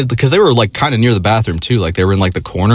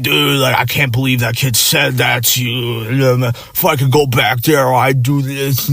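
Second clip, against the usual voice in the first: put-on drunk slur; 4 semitones higher, slower than usual. Drunk coworker voiced.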